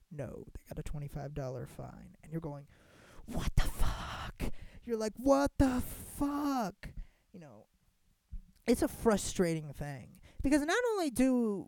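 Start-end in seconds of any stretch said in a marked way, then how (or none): 3.2-4.4 whispered disbelief. Retold or imitated. Outraged at twenty-five dollar fine. 5.2-6.9 slow, higher, stunned exclamation; outraged disbelief. Retold or imitated. Reacting to a twenty-five dollar fine, slow stunned outrage.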